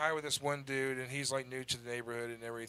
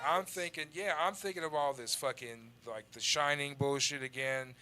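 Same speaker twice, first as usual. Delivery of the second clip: ordinary delivery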